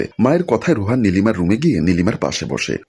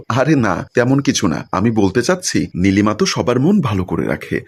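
ordinary delivery

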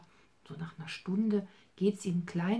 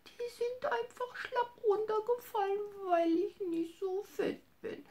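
sad little bunny. Acted. Bunny Hops tells grandma he feels sick, high crying whimper.

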